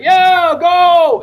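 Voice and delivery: high, loud crowd-style yell; imitated yelling. Host imitating those who hate you, yelling and screaming. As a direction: whoop it out like them yelling and screaming. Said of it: "they're yelling and screaming".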